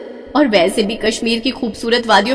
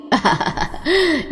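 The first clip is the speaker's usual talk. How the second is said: soft amused laugh; 6 semitones higher, 5 dB quieter than usual. Acted. Mother-in-law laughs at complaint.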